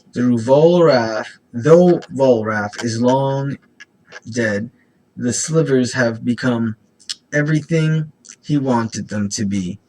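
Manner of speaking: slow, loud, solemn; dramatic lore narration. Acted. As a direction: intone it like an epic saga. Reads flavor text dramatically.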